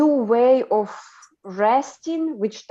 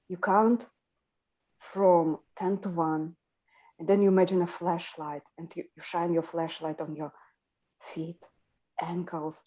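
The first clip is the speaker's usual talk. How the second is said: soft, lower meditation voice; relaxation guide; 4 semitones lower, 7 dB quieter than usual. Retold or imitated. Guiding people into a relaxation state, imitated soft and low. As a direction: talk them down gently, fading very quiet. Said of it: "he gets people into this relaxation state".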